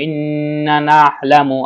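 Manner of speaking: ইন্না with a long held nasal nn, slow; nasal ghunnah. Shown as a demonstration. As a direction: hum the নন in ইন্না before নাহলামু. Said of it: "গুণনা করা ওয়াজিব".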